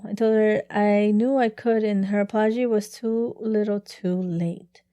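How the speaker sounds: normal talking voice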